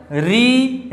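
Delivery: "री" with a long, held ee; demonstrated sound. Shown as a demonstration. Teacher showing the front of the tongue working, demonstrated sound. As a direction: stretch "री" out steadily. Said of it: "जीभ का अगला भाग काम कर रहा".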